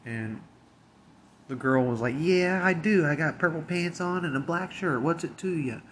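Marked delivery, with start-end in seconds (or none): 2.2-5.8 mocking retort. The woman mocking the coworker's call, high and snotty. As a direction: throw it back as a bratty taunt.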